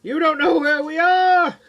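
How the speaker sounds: high, loud calling after him; calling out to Keith flying off; shouting. A narrator's reading. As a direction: yell it across the distance. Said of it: "Jay shouts".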